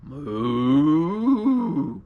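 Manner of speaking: long, swelling imitated cow moo; storyteller's imitation of a cow mooing. A narrator's reading. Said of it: "that cow went".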